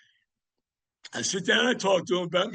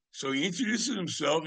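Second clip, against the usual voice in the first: ordinary talk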